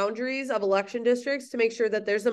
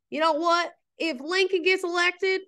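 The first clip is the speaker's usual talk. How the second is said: put-on Southern accent; 8 semitones higher than usual. Teacher voicing South Carolina's threat, in a Southern accent. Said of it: "I'm gonna talk in a Southern accent".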